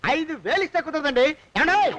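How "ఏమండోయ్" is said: higher, rising holler; calling out. Acted. Creditor haggling with the master, calling out loudly. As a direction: holler to push the price up.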